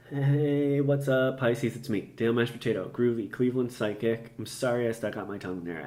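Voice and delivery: normal talking voice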